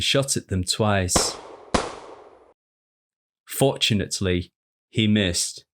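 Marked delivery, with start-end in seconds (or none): none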